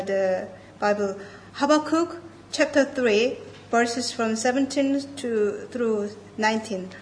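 ordinary talk, nothing marked

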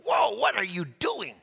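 hammed-up shout at the paramedic; imitated yell. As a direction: cry out as if jostled while hurt. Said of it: "he had every right to yell".

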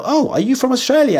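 chirpy, oblivious question; clueless tourist. Pretend. Traveller mistaking a New Zealander for Australian, high and chirpy. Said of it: "You'll meet a New Zealander and go".

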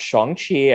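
"Shang-Chi" said as "Shong-Chee"; first syllable of "Shang-Chi" sounding like "shong"; correct Shang-Chi pronunciation. Retold or imitated. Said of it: "it's actually pronounced".

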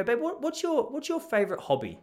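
mock sweet question. Husband asking his wife her hobbies, a mild sing-song. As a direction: ask it in an over-sweet tone.

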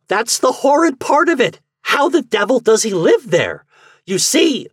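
very high, loud, agitated outburst; ever-growing excitement. A narrator's reading. Garvey ranting about Marx, wildly excited. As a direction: let the excitement run away with you. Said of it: "with ever-growing excitement".

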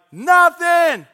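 high, loud holler back; yelled answer. Retold or imitated. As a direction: shout the reply across the yards. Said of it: "I would scream at Adrian".

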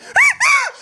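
high shrieking burst of laughter. Real, not acted. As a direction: let out a squealing laugh. Laugh burst mid-story.

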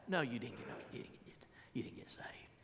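dropping into a low whisper; mock-whisper a needling denial; hushed doubter. Doubters deny the preacher got saved, hushed needling whisper. Doubter denying his conversion, acted.

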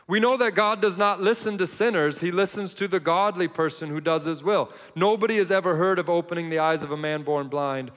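loud, high, fired-up preaching; full-force preaching. Acted. Pastor to congregation, about the healed blind man, fired-up preaching. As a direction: shout the joy of the healed man.

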